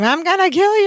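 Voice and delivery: high mock scream at Larry. Jennifer screaming at Larry over the phone, mock shriek. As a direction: shriek it in a put-on woman's voice. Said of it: "You could hear her scream".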